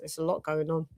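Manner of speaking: normal talking voice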